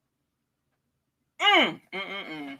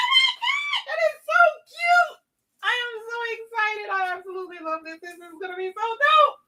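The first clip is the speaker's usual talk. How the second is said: excited squeal. Real, not acted. Trying the jersey on.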